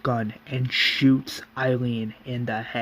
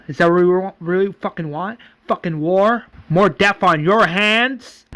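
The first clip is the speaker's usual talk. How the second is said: raised, incredulous questioning; angry challenge; 5 semitones higher, 5 dB louder than usual. Acted. Roland challenges the Governor over more death, angrily. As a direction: throw each question as a rising accusation.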